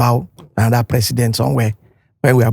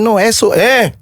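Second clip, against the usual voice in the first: higher, louder, a mock foreigner fumbling the name; mimicking foreigner; 9 semitones higher, 3 dB louder than usual. Guest mocking a foreigner's fumbled attempt. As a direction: imitate the confused foreigner. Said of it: "No, he said".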